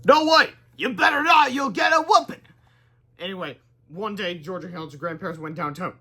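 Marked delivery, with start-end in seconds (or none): 0.1-2.4 high, loud mock granny scolding. Granny warning grandkids not to trade her in, loud scold. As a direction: shout it like a feisty old granny.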